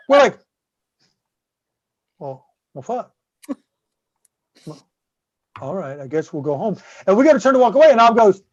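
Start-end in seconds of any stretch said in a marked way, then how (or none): 2.2-6.8 deflated mutter. Retold or imitated. Told the event is next week.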